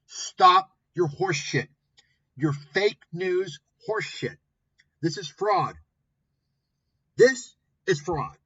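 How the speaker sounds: furious, hammering each line at him; angry rant. Real, not acted.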